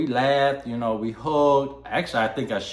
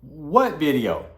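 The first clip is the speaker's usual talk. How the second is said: surprised; 5 semitones higher, 6 dB louder, slower than usual. Retold or imitated. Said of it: "I was like".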